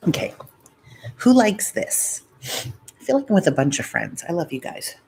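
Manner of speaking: ordinary talk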